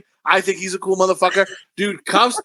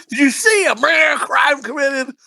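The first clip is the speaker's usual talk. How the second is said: high, shouted movie-quote impression; blurred-out movie swearing; 6 semitones higher than usual. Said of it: "it keeps blurring it out".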